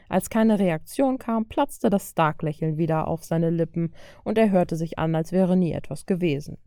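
usual speaking voice

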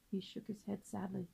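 usual speaking voice

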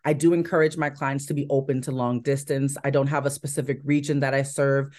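ordinary talk

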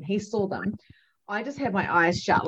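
ordinary talk, nothing marked

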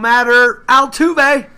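building to a loud, high shout; rousing hometown rally cry; fervent homer cheer. Pretend. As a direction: proclaim it like a die-hard home fan. Contestant proclaims his answer with fervour.

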